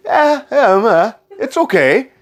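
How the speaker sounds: high-pitched, hesitant hedging; noncommittal. Real, not acted. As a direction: hedge it in a high, doubtful voice. Hedging on whether bed is comfortable.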